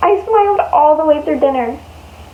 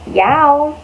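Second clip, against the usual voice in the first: plain reading voice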